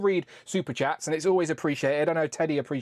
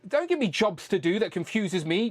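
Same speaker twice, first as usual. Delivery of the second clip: usual speaking voice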